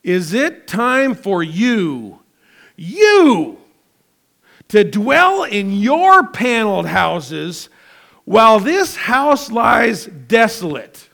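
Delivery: God's shouted question. Retold or imitated. Preacher voicing God confronting the people, slow shout. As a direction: thunder the question slowly. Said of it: "asking the question ... like this".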